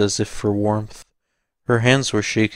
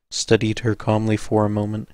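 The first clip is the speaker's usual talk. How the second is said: ordinary delivery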